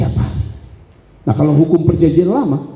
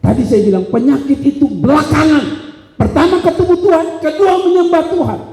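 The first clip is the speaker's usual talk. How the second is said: high, ringing list of points; emphatic preaching; 10 semitones higher than usual. Acted. Preacher recapping priorities to the congregation, emphatic. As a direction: preach each point in a raised voice.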